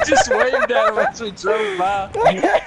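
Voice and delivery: giggling the words out; high laughter. Real, not acted. Telling friends they waved at the victim, laughing hard.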